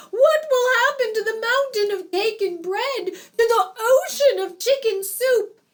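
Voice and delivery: high, loud wailing shout; panicked parents shouting. A narrator's reading. Bride's parents fret over the wedding food, shouting. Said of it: "Leah's parents were shouting".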